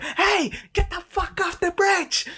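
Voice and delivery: high mock shout at kids; imitated yell. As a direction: mimic her yelling at the kids. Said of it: "And you're like".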